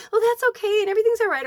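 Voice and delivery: high, sing-out mock reassurance; breezy reassurance. Go-getter friend always telling others it's fine, high mock reassurance. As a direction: imitate someone brushing trouble off, voice up high.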